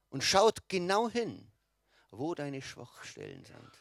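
ordinary talk

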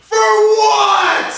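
very high, loud demand; shouted demand. Acted. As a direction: yell the question.

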